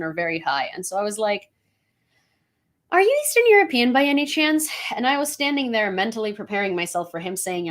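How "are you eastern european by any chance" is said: nervous question. Retold or imitated. Asking stranger, nervous about answer.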